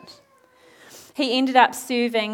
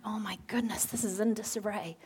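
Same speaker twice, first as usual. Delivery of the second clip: soft, near-whispered shock, acted; hushed dismay; 8 dB quieter than usual. Nehemiah scouting out the ruined land, hushed dismay. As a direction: murmur it as if taking in the damage.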